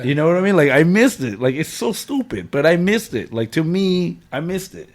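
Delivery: telling friends he missed his daughters' first haircut, emphatic; emphatic hurt. Real, not acted. Upset repeating what he missed.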